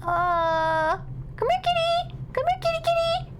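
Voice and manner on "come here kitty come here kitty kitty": very high kitty-calling voice; high singsong pet call; calling a cat. Pretend. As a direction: coax the kitty in a squeaky high pitch.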